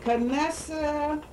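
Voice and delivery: drawn-out, sounding out the name; slow careful name. Pretend. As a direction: stretch the name slowly.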